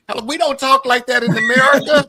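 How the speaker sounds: loud, high, quick protest; amused disbelief. Real, not acted. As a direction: exclaim it in mock outrage. Mocks scammers' un-American phrasing.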